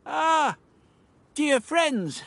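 high, effusive greeting; high-pitched theatrical welcome; delighted cry. Acted. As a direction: hail them like long-lost friends. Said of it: "the doctor cried".

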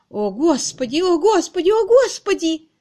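high lament climbing with each cry; wailing lament. A narrator's reading. Miss Twitterton hearing the row, wailing. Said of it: "причитала мисс Твиттертон".

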